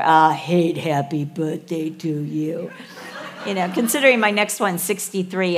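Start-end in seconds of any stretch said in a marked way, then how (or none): 0.0-2.7 put-on mild toddler mumble, slow; a hint of the sulky toddler; lightly voiced toddler. Family joke, a light toddler mumble. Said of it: "this is what we say".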